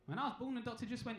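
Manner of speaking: normal talking voice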